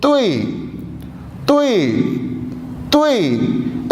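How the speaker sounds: careful tonal demo; Mandarin word drill. Shown as a demonstration. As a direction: model तुई for the class three times. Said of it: "इस case में देखें त उ ई".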